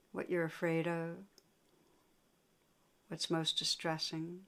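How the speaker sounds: unhurried meditation guidance with long pauses; guided meditation. Real, not acted. Teacher guiding listeners to sense painful emotions, guided meditation. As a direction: lead them inward, leaving long silences between phrases.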